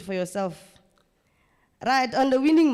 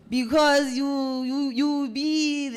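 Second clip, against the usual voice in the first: very slow, higher and louder; drawn-out hesitation; 5 semitones higher, 5 dB louder, much slower than usual. Real, not acted. Presenter stalling before a cheeky point, slow and higher. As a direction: stretch it out searching for words.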